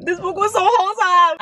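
high-pitched overjoyed whine; gushing squeal. Real, not acted. Gushing over wholesome book.

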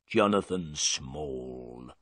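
low, slow sullen answer; gruff prisoner. Acted. Prisoner tells Holmes his name, low, slow and sullen. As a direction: give your name grudgingly, low and slow.

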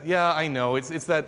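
ordinary talk

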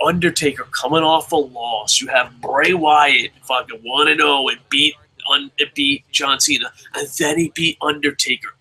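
ordinary talk, nothing marked

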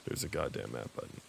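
very low, quiet resignation; soft, deflated swearing under the breath; deflated mutter. Real, not acted. Finds map button too late.

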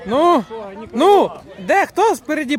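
high, loud prodding; impatient shout. Real, not acted. Asking the lads who is up front, shouting. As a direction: raise the voice to chivvy the team. Urging players forward.

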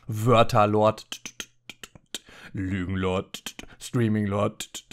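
low, slow title voice; deep announcer voice. Acted. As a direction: intone it like a grim epic title. Tiefe Titelansage. (tiefe, langsame Ansagerstimme)

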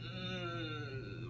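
normal talking voice

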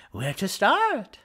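high, rising bookseller voice; thrilled shopkeeper. Acted. Bookseller wonders where to begin the tour, high and thrilled. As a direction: let it soar with eagerness.

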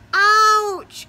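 loud little yelp; cried out. Acted. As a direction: yelp it in mock pain. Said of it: "cried the spider".

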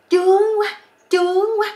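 very loud, high-pitched mock female cry. Young woman in bed ignoring the knocking, loud comic crying out. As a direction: belt it out high, hammed-up. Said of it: "mười chín tuổi mà nó la".

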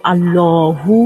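"আল্লাহু" as a rounded "Allooo-hu"; long rounded middle vowel in "আল্লাহু"; heavy lam. Shown as a demonstration. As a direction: say "আল্লাহু" with a thick L. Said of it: "মোটা করে".

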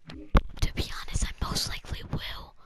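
unvoiced, secretive whisper; whispered confession. Real, not acted. Gamer admitting to viewers he will sell, whispered. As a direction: whisper it like an admission. Confides to viewers, then repeats aloud.

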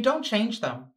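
ordinary talk, nothing marked

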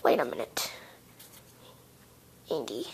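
hushed suspicious whisper. Acted. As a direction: breathe it, suspicious.

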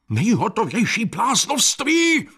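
high, indignant yell; high-pitched scornful cry; shouting. A narrator's reading. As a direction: shout it out in outrage. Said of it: "křičel pan doktor Link".

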